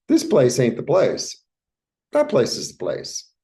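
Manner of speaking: loud, bright and eager; excited. Real, not acted. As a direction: gush over the good news. Excited reading of the cards.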